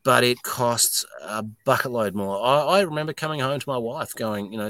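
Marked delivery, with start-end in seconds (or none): none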